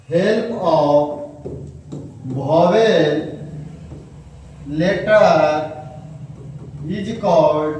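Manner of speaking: very slow dictation, each word spaced. Shown as a demonstration. Teacher dictating the definition slowly to pupils. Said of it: "dictating definition slowly".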